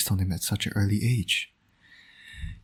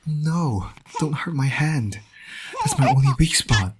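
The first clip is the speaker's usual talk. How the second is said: hammed-up, higher yelps of fake pain; mock pain; 6 semitones higher than usual. Playing with the baby son, faking hurt over his grip. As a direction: pretend the baby's grip really hurts.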